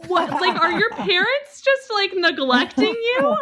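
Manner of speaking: very high, loud laughing outrage; incredulous aunt. Retold or imitated. Aunt questioning her niece's upbringing, loudly incredulous. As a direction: act out an aghast aunt, stretching the words.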